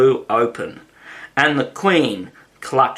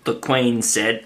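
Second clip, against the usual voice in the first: plain reading voice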